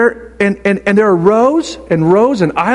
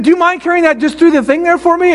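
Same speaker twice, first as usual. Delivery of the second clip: higher, pleading ask; mock sweet request; 9 semitones higher than usual. Pastor to stranger behind him, higher polite plea. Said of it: "I said".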